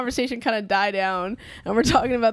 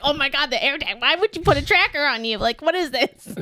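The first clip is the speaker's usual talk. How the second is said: imitated rant; 5 semitones higher than usual. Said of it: "I hit the repeat button on my rant".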